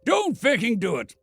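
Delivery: angry. Acted. Ronson threatens the boy.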